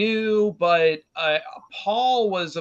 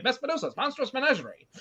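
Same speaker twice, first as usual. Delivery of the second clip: carnival barker calling it out, higher and fast; old radio announcer; 7 semitones higher, 6 dB quieter, faster than usual. Acted. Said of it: "sounded like something a carnival barker, ... old-timey radio voice would say".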